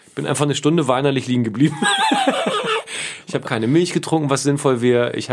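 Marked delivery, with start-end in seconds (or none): 1.8-3.2 high giggle. Real, not acted. Laughs at own story.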